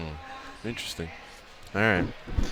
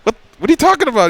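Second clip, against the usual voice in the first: high, fast, disbelieving what; hammed-up confusion, pitched way up; mock baffled; 14 semitones higher, 5 dB louder, much faster than usual. Host retells his shock at Josh's call, mock baffled.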